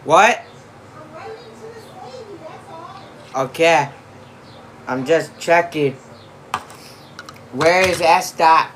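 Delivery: shouting to another room; yelling across the house. Real, not acted. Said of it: "I can't keep yelling across the house".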